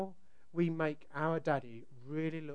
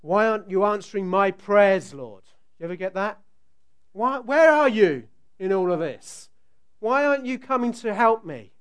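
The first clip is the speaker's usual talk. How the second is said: anguished prayer; 4 semitones higher, 13 dB louder than usual. Retold or imitated.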